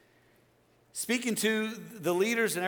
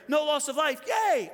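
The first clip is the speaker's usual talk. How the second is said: high, loud pretend cheer; hammed-up relief, pitched way up; mock celebration; 10 semitones higher, 5 dB louder than usual. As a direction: cheer it as if thrilled by the news. Imagines himself hearing the news.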